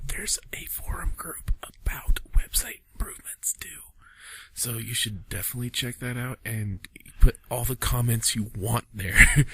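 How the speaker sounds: hushed secretive whisper. Real, not acted. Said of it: "why are you whispering?".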